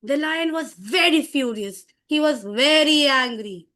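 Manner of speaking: dramatic narration swelling on VERY ANGRY; demonstrated storybook fury; showing anger. Shown as a demonstration. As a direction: show the lion's anger, slowing into the second sentence. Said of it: "you have to show anger".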